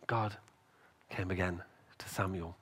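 normal talking voice

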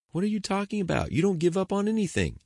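can't believe what he's hearing; disbelief. Acted. Jared shocked at David giving up.

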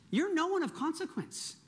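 high-pitched, belittling accuser; accusing enemy. Acted. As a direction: put them down as the enemy would. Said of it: "The enemy loves to tell us that".

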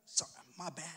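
barely audible quick sorry; hushed apology. Retold or imitated. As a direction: toss off a soft apology. Jairus pushing through crowd.

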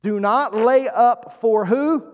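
"Do not lay up" slow, "who" rising at the end; emphatic prompting question. Shown as a demonstration. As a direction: quiz the congregation, stretching the words up to a rising "who". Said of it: "The emphasis here".

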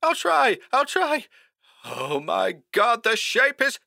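panicked high-pitched telephone voice; terrified. A narrator's reading. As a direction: gasp it out high and helpless, then break off.

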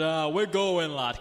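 a hint of Northern English; lightly voiced Northern dad; Northern accent. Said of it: "'cause that's Northern".

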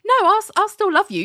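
high, sing-song reassurance; mock sweet Larry. Larry on his knees reassuring Ali, mock sweet. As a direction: coo it sweetly. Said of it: "It's like".

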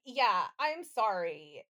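normal talking voice

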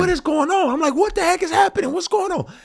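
very high stunned what is going on; disbelief. Retold or imitated. Artist reacting to a second Grammy win in disbelief. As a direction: squeal in disbelief. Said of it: "I'm just like".

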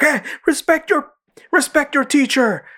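very high, slow cartoon scolding; high mock authority figure; imitating PC Principal. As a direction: lecture them in a squeaky put-on voice. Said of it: "The P C principal was like".

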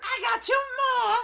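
high, shouted villain comeback; goblin woman retort. Acted. Vorka answers Chuff about her dead dog, shrill shouted retort. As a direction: yell it back in a high goblin voice.

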